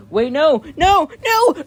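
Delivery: high, loud, desperate cries; panicked scream. Acted. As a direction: yell as they break through the window. Batteries break in, panicked shouting.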